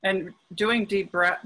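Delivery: usual speaking voice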